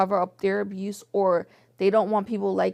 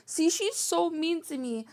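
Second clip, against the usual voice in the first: higher, slow whiny victim voice; mock whiny victim; 7 semitones higher than usual. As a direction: whine it like playing the victim. Mimicking her playing victim.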